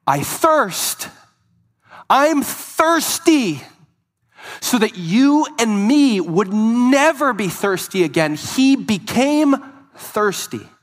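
high, loud, slow cry of thirst; anguished cry. Acted. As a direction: stretch it out like a dying cry. Cry from the cross, preached.

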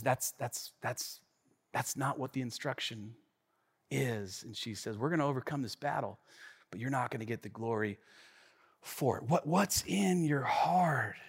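soft, low prophetess voice; calm quiet Deborah. Retold or imitated. Deborah quietly correcting Barak, soft and low. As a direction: correct him quietly and gently. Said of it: "And Deborah says".

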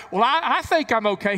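high, loud mock self-satisfied voice; mock the self-assured sinner; smug excuse. Preacher mocking a churchgoer's excuse, high and smug. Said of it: "mock self-justifying churchgoer".

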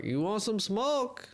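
slow, high-pitched mock Jamaican hustler; bad Jamaican accent. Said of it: "That's not a good Jamaican accent".

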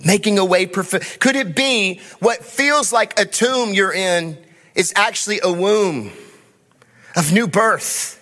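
rising, fervent sermon delivery over the band; impassioned preaching. Acted. Pastor to congregation, fervent preaching. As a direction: preach it out with building conviction.

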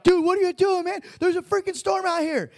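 scared men yelling over a storm; panicked disciples. Retold or imitated. Panicked disciples waking Jesus.

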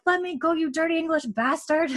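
put-on high-pitched accented little boy; a struggling boy's high, indignant protest; French pickpocket boy. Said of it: "little boy starts speaking English with such an accent".

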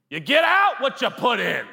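high, shouted coach slogan; football coach. Acted. Jesus as a football coach pushing the team, mock shout. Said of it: "like the science teacher or the football coach".

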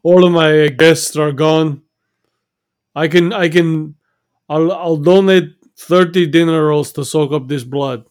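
loud, exasperated restaurant owner; upset shouting. Acted. Chef yelling at diners about the blood everywhere, loud. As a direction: shout at the customers over the mess.

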